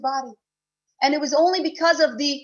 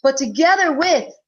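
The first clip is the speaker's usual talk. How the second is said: louder, impassioned sermon build; fervent preaching; 6 dB louder than usual. Acted. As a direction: preach it from the depth of the heart. Preaches with rising force.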